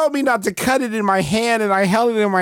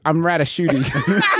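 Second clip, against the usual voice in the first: normal talking voice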